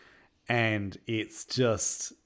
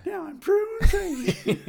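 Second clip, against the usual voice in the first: high squeal through laughter; laughing; 14 semitones higher, 10 dB louder, slower than usual. Real, not acted. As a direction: crack up while saying it. Laughing at joke.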